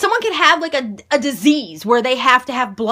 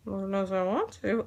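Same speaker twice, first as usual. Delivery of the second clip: softer, half to herself, as if it slipped out; sly quiet aside; 7 semitones lower, 8 dB quieter than usual. Acted. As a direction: let a naughty thought escape under the breath. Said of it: "did I say that out loud".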